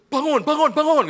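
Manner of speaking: shouted high wake-up call; mock wake-up call. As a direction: yell at them to get up. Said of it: "saya telepon tiap pagi". (teriakan membangunkan jemaat pagi-pagi)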